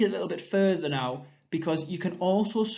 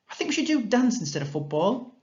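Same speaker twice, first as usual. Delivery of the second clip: put-on high, bright child's suggestion; voicing Nancy; 8 semitones higher, 3 dB louder than usual. Shown as a demonstration. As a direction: pitch the idea eagerly to friends.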